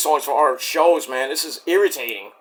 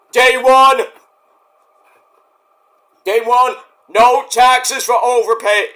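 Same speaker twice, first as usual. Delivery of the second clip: Trump speech impression; 5 semitones higher, 5 dB louder, slower than usual.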